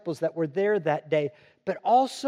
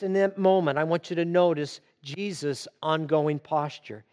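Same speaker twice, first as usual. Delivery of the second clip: normal talking voice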